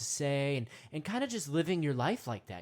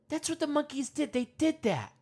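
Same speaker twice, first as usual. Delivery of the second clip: ordinary talk, nothing marked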